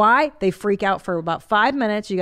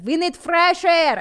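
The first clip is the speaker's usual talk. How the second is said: put-on slow, higher and louder Hungarian accent; Hungarian mother's accent; 8 semitones higher, 4 dB louder, slower than usual. Teaching his sons in the morning, Hungarian accent. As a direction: announce it slowly like a Hungarian mom opening windows. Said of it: "I say it in my mother's accent".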